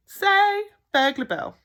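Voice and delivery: high, loud coaxing; teaching a baby. A narrator's reading. Bill coaxing the baby to say his name, high. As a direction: sound it out brightly for the baby.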